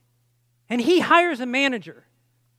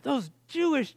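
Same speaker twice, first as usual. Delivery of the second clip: angry. Retold or imitated. Preacher recalling his anger at those who rejected Jesus, angry. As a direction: stretch it out in exasperated anger. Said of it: "I just began to get angry".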